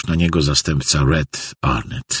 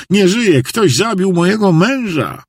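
very high, distraught wail of a wife; hysterical cry; 14 semitones higher than usual. A narrator's reading. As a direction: cry out the horror at high pitch. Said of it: "Po prostu krzyczała przeraźliwie". (rozpaczliwy, wysoki krzyk)

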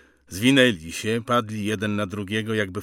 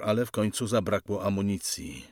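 plain reading voice

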